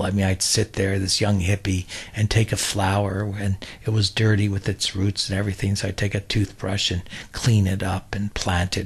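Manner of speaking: usual speaking voice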